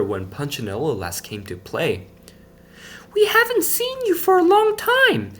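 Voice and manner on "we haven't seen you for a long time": put-on much higher, small girlish friend voice; a high little friend missing him; Lucia's voice. A narrator's reading. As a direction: Lucia gently calls to her friend. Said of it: "his friend Lucia said".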